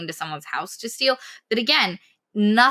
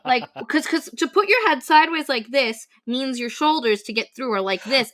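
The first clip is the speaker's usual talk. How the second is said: ordinary delivery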